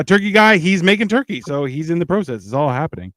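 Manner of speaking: usual speaking voice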